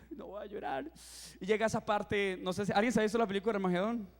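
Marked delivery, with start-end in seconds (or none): 0.1-0.9 softer, choked whisper of a promise not to cry; swallowing the tears, barely voiced; holding back tears. Preacher at the movie, fighting tears: a soft, choked vow. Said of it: "Y ya tenía así".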